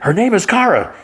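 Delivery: high, emphatic mock outburst; exasperated declaration. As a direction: correct her in an acted, high-pitched burst. Jimmy corrects princess emphatically.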